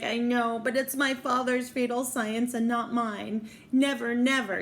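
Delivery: theatrically distressed denial; acted desperate plea. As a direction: plead innocence like a tragic heroine. Girl desperately defends herself to lover.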